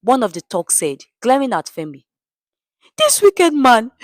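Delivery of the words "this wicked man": high, loud accusation; woman accusing her husband before the crowd; crying out. A narrator's reading. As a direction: cry out against him to the crowd. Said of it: "the woman cried".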